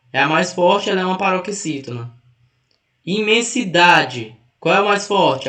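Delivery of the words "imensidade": "Imensidade" slow, with weight on DA; teacher showing DA as the strong syllable of "Imensidade"; stress on penultimate syllable. Shown as a demonstration. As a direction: lean on the DA of "Imensidade". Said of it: "É o da".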